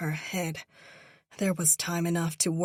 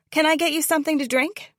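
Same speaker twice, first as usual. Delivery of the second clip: chirpy waitress; 10 semitones higher, 7 dB louder, much faster than usual. A narrator's reading. Waitress greets diners.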